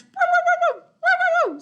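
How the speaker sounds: high, loud barking woos; alarm barking. Acted. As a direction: bark like a watchdog. Dog barks at doorbell.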